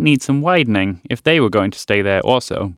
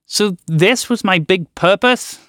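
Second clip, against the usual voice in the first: shouting; 6 semitones higher, slower than usual. A narrator's reading. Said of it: "the frog shouted".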